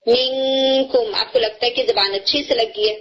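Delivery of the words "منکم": منکم with the noon hidden, drawn out very slowly; ikhfa demo. Shown as a demonstration. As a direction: hold منکم long, tongue pressing firmly for the hidden noon. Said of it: "جب آپ اخفا کرتے ہیں".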